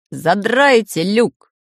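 slow, loud captain's order; commanding. Acted. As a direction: bark it like a ship's captain. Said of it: "скомандовал Самоделкин". (капитанская команда, медленно и громко)

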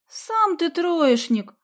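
high, drawn-out indignant retort; loud, slow, outraged comeback. A narrator's reading. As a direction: throw the insult back, offended. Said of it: "возмутилась Соня".

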